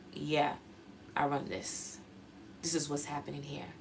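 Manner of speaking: quiet, low, matter-of-fact; just state it softly; softly spoken self-assurance; calm and normal. Shown as a demonstration. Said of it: "the word I would use is very normal".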